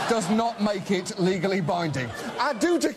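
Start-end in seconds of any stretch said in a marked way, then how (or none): 2.4-3.0 high, drawled belle voice; Southern debutante. Acted. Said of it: "in the voice of a Southern debutante".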